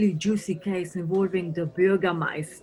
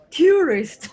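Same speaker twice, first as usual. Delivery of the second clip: scornful loud jab, higher; mocking yell; 7 semitones higher, 7 dB louder than usual. Inspector sneers at American tourists, loud mocking cry. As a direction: spit the word out with contempt.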